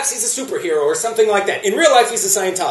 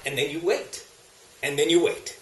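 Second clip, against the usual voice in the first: hushed and low, sinking almost to nothing; 7 semitones lower, 12 dB quieter than usual. Acted. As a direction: let the wait fade down very low, very soft. Comic endless waiting, dying away.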